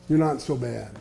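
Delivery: hushed repeat of "You're not so bad"; soft echo. Shown as a demonstration.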